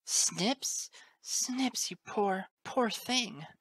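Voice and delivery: high, tender worried woman; anxious concern. A narrator's reading. Teacher scooping up choked colt, high anxious concern. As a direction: fuss over the hurt child in a high voice.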